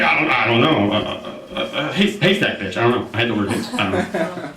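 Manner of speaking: low, lightly voiced mumbling buddy; low, fumbling drunk excuse; confused drunk friend. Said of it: "And he's like".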